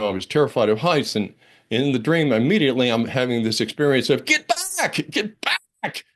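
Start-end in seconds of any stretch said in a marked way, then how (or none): 4.2-6.1 sudden high, urgent cry of alarm; frightened warning. Retold or imitated. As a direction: pull yourself back from the edge in panic. Terror at the dream precipice.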